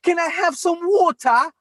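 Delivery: put-on high little-girl British accent; daughter's British accent. Little daughter asking daddy for water, high British accent. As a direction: imitate a small child with a British accent. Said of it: "British accent".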